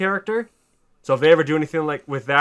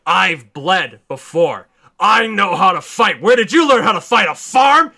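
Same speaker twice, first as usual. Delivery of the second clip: taunting villain; 4 semitones higher, 6 dB louder than usual. Retold or imitated. Zod taunting Superman while beating him. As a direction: build from cold to a mocking shout.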